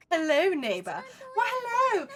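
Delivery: loud, high, giggly hello; excited greeting. Real, not acted. Greeting a neighbor from a nearby country, excited. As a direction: greet them with a high, delighted shout.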